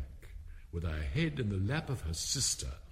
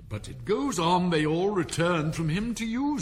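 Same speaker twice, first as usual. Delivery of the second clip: higher, louder protest from Alice; objecting Alice; 8 semitones higher, 6 dB louder than usual. A narrator's reading. Alice objecting to the King in court, insistent and loud. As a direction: insist on the point.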